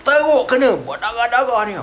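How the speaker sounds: normal talking voice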